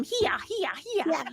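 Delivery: high chanting critter cry; creature call demonstration. Acted.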